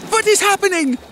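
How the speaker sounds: high panicked cry; frightened strained shout; trying to shout. A narrator's reading. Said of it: "I tried to shout against the suffocating liquid".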